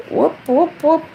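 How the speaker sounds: high cheerful whoops; a little celebratory cheer; playful whoop. Real, not acted. Vlogger cheering to her live viewers, high playful whoops.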